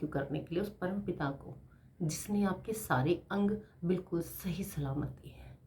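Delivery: hushed, breathy thanks; reverent whisper. Real, not acted. Whispering her gratitude to God. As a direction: whisper it like a prayer.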